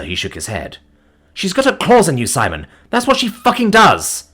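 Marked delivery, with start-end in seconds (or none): 1.3-4.3 high and loud, scornful; bitter accusation. Acted. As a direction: spit out the accusation. Sean's angry tirade.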